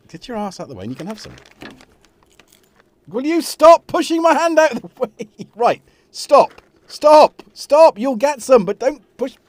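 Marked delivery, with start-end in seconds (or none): 3.1-9.4 high, loud telling-off with a laugh creeping in; exasperated scolding. Real, not acted. Owner to cat pushing the hand away, exasperated scolding. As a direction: raise your voice sharply at a naughty pet.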